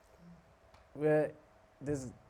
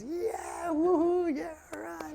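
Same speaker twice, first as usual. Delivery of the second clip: high, thin imitation of cheers; cheering crowd; slower than usual. Whole carriage applauds the chanting, imitated cheering. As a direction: mimic a far-off whooping crowd, pitched way up. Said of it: "erupts in applause".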